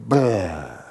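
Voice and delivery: drawn-out, falling "bleh" of gushing water; imitated dam burst. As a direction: imitate a dam giving way in one long burst. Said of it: "and goes".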